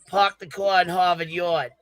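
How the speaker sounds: careful put-on Boston accent; practised Boston accent. Shown as a demonstration. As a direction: drop the r's like a Bostonian, slowly. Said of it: "I've been practicing this".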